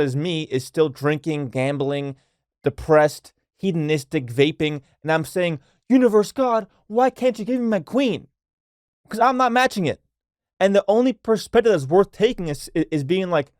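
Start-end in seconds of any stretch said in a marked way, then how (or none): 5.9-10.0 high, whiny voice begging the universe; whiny plea to God. Acted. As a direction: ham up a self-pitying complaint, pitched up.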